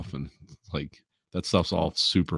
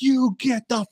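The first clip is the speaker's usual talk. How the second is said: high, sharp hammed-up scolding; mock angry yelling; 12 semitones higher than usual. Boss scolding the contractors, high mock rage. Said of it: "They're like".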